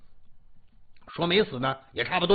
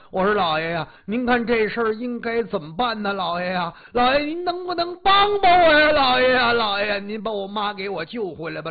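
tearful crying out; 3 dB louder than usual. Acted. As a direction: beg the master through hammed-up sobs. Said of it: "哭着喊着".

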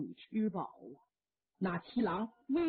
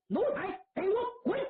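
high, fast, biting shout; enraged old general roaring; furious order; 9 semitones higher, faster than usual. Acted. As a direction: throw him out. Father drives son away.

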